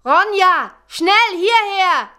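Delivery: loud, high, drawn-out shout; urgent call. A narrator's reading. Birk summons Ronja to safety, calling urgently. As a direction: call someone over from a distance.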